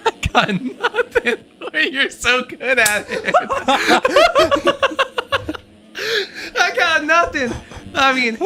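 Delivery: laughing. Real, not acted. Giggling after partner's impression.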